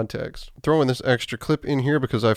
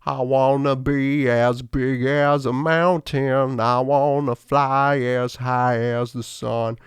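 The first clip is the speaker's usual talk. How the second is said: spoken slowly in a light southern gentleman drawl; a hint of a Foghorn Leghorn drawl; slower than usual. Shown as a demonstration. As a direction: say it like an old southern gentleman, slow. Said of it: "how you would say it if you were talking".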